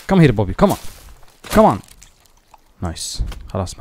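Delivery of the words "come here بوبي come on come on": loud call, rising on come; calling a dog. Real, not acted. Gamer calling his dog Bobby, loud call. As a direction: call the dog over to you.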